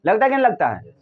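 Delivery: ordinary delivery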